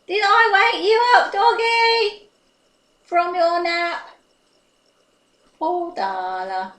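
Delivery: high, loud cooing to the doggy; baby talk to a dog. Acted. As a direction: coo at the pet like a doting owner.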